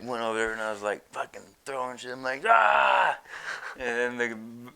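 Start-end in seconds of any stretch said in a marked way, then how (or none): 2.5-3.2 much higher, louder ah shout; short shouted ah; mock yell. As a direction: yell ah to scare the geese. Yelling at the geese.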